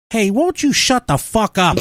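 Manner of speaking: put-on a stranger tells the anti-masker off in a Boston accent. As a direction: bark it like a rude Bostonian. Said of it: "someone in a Boston accent walks up and goes".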